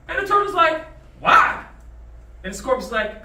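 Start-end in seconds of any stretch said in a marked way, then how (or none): 1.2-1.6 loud, sudden burst; loud acted outcry; mock indignant demand. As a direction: fire it out as an affronted turtle. Turtle demands reason for sting.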